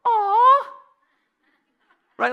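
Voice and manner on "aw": high, loud cooing aw; mock sympathetic aw. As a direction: coo like at a puppy. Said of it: "he goes".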